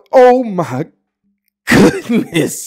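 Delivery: high, loud drawn-out oh my goodness; overwhelmed by the drip; amazed exclaim. Real, not acted. Staring at the outfit, a loud amazed exclaim.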